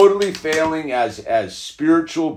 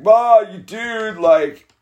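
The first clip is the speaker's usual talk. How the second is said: mocking son; 10 semitones higher, 4 dB louder, slower than usual. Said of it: "And he's like".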